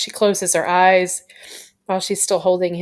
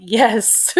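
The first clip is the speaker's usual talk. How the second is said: higher, thrilled little yes; excited yes; 5 semitones higher than usual. Retold or imitated. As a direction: cheer it like a happy fan. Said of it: "I was like".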